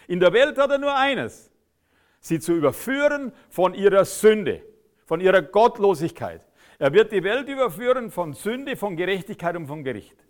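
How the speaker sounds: forceful sermon climax; fiery preaching. Acted. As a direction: hammer it home to the congregation. Preacher drives home a point.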